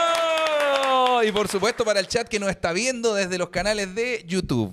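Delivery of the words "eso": excited shout. Real, not acted. Cheering applause call.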